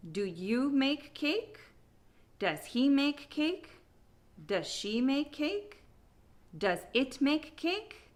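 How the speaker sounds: "cake" lifts upward to end each question; slow yes-or-no drill, pitch climbing to a high "cake"; rising question intonation. Shown as a demonstration. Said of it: "intonation on questions in English always goes up".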